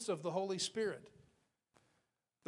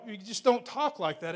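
ordinary talk

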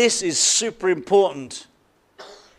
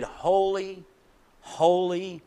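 hushed reverent "holy"; 6 dB quieter, much slower than usual. Shown as a demonstration. Quiet opening of the hymn.

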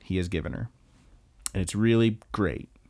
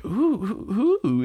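high, eager teasing coo; pry with hammed-up curiosity, pitch way up; mock nosy excitement; 11 semitones higher, much slower than usual. [name] asking Erica about her crush, mock nosy excitement.

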